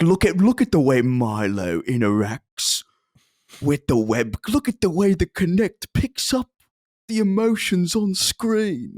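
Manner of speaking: slow, drawn-out mock English accent; Peter Molyneux. Podcaster spoofing a game developer's demo, slow English accent. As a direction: spoof a British game developer's pitch, lingering on words. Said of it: "it's very Peter Molyneux".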